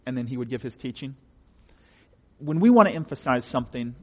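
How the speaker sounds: usual speaking voice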